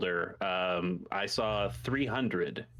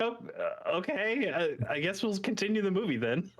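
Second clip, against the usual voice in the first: awkward parent; 8 semitones higher than usual. Retold or imitated. Parents voiced, awkward retreat.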